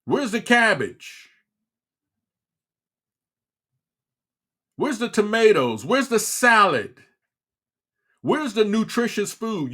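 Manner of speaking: indignant demand. Real, not acted. Ranting at junk-food mother.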